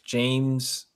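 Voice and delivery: ordinary delivery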